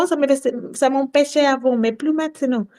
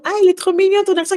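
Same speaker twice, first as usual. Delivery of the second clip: quick, higher gushing voice; fast cooing imitation of admirers; gushing compliment; 8 semitones higher, 3 dB louder, much faster than usual. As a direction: rattle off a sweet compliment, mock gushing. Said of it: "Ils me disent".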